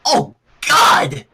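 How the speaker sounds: mild retching cry; a hint of a gag in the voice; disgusted cry through a gag; gagging. A narrator's reading. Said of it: "Rainbow gagged".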